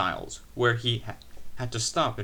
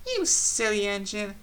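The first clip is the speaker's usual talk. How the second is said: fond scolding; 9 semitones higher than usual. A narrator's reading. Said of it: "she said".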